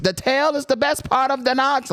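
high, stretched-out disbelief; comedian's joke delivery. Retold or imitated. Retelling the oxtail punchline, high comic impression. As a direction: imitate a comic's incredulous punchline, slowly. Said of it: "They're like".

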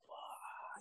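imitating a stunned, whispered reaction; very soft, crushed exhale of a swear; deflated whispered curse. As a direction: breathe the curse out, barely voiced. Retold crushed reaction to harsh critique.

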